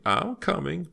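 very high, singsong repeated call; insistent calling out. A narrator's reading. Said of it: "Missus Cartier called from behind Myra".

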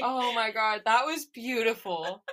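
choked up and emotional; tearing up. Real, not acted. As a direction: voice thick with real tears. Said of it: "tearing up right now".

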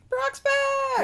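high, loud happy shout; excited cheer. Real, not acted. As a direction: celebrate like a fan. Cheering Brock's return.

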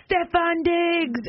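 high, drawn-out mock yell; imitated yelling. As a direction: call it out slowly as if across a park. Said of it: "He is then yelling from the kiosk".

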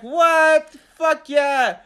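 loud, high, re-enacted whoop of delight; excited shouting. Man retelling his reaction to partner's news, elated shout. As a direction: holler it like a thrilled supporter.

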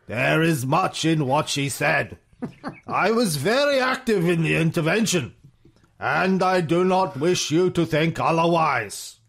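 theatrical statesman's oratory; pompous, measured declaration; Churchill. Acted. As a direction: intone it like a grand old statesman. Reads Churchill's own quoted speech.

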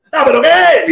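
high, drawn-out mock outrage; worked-up challenge. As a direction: imitate an overexcited man taking offence. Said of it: "tenía una actitud un poco, mm, sobresaltada".

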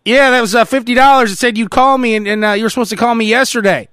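put-on high, loud, put-out old man; complaining old fan. Fan telling Ric Flair the call came a day late. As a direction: grumble about the late call. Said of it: "I'll show you what it's probably like".

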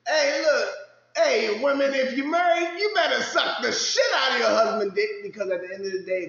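high, loud preachy yelling; mock lecture shout. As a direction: shout advice at women like a preacher.

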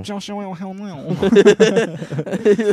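put-on slow, drawn-out gibberish French accent; mock French accent. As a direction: stretch it into garbled cartoon French. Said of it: "Why do French people sound like".

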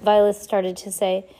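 plain reading voice

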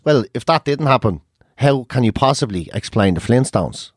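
low, deadpan mock question; imitating the mate. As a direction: ask it dead serious for the joke. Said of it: "me mate goes".

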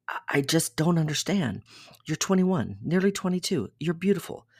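mildly acted exasperation; exasperated bewilderment. A narrator's reading. Acted bewildered, exasperated lover in story.

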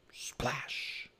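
soft, breath-only splash sound effect; hushed, hissing splash; whispered splash. A narrator's reading. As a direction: whisper the splash like spraying water. Story sound effect, voiceless.

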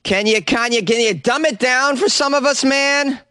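squeaky put-on voice begging; high mocking whine; mock whiny plea. Mocking producer's request.

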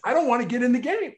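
high, fast whiny refusal; a hint of a pouting teen; mimicking player. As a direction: play the reluctant player lightly.

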